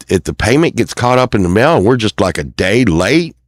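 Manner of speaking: ordinary talk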